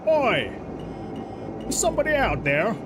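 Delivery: hammed-up Aussie-Scots guard calling out; clumsy imitation of a Scottish or Australian accent; bad Scottish-Australian accent. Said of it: "Scottish or Australian or whatever accents".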